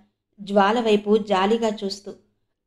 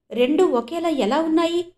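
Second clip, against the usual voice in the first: stunned, high-pitched question; baffled disbelief at the matching bracelets; 7 semitones higher, 3 dB louder than usual. A narrator's reading. Kireeti sees the bracelet on Gopichand's wrist, stunned. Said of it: "అని విస్తుపోయాడు".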